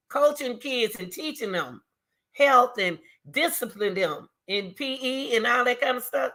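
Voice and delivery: ordinary talk, nothing marked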